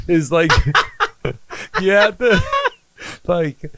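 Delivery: high, helpless laughing speech; breaking up laughing. Real, not acted. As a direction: try to talk through the laughter. Cracking up over album title.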